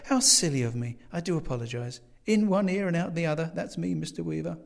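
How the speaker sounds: lightly voiced, fluttery self-reproach; apologetic landlady. A narrator's reading. Landlady apologising for forgetting guest's name, lightly self-mocking. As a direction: scold yourself lightly.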